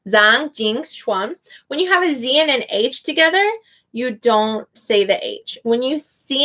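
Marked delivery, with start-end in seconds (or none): 0.1-1.4 "Zhang Jin Chuan" said slowly with a clear "ah" in Zhang; slow English-accented name. Shown as a demonstration. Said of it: "saying it with an English accent".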